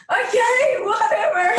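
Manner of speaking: high, loud exasperated outburst; exasperated teen. Retold or imitated. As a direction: throw it out like a fed-up teenager. Acts out exasperated rebuffed daughter.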